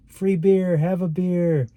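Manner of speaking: high, loud shout of a partying student offering beer; party kids calling out. Retold or imitated. Students partying on the walk calling out free beer. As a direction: call it out like a rowdy college kid pushing free beer.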